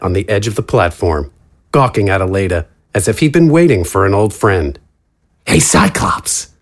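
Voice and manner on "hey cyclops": lower, slow, breathy taunt; taunting villain. Acted. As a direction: sneer it at her like a thug.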